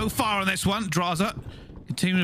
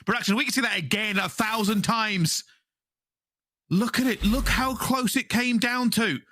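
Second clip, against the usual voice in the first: higher, emphatic caster hype; excited play-by-play; 4 semitones higher than usual. Real, not acted. Caster hyping how close that point is, emphatic excited play-by-play. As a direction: marvel at it, punching each phrase.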